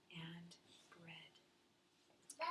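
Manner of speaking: soft whispered word; storyteller's whisper. A narrator's reading. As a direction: hush it like a secret. Bedtime story told to child.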